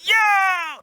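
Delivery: high, loud call across the room; hey, it's you!; excited shout. Retold or imitated. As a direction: yell out a happy greeting. Coworkers greeting him across restaurant.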